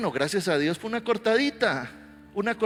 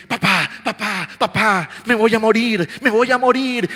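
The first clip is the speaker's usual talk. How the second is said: imitating a frightened boy, higher and louder; panicking child; 6 semitones higher, 11 dB louder than usual. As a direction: cry out to dad that you're dying. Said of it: "el niño corre así".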